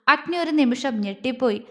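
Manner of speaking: ordinary delivery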